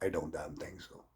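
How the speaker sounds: ordinary talk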